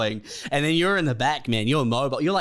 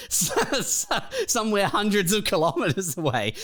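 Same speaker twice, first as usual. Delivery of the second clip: laughing through the words; words spilling out mid-laugh; 7 semitones higher than usual. Real, not acted.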